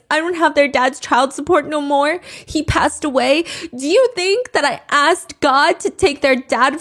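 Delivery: high, loud whiny imitation of her tearful rant; mock crying streamer. Re-enacting a crying streamer's rant to viewers, whiny and high. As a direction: parody her teary plea to viewers.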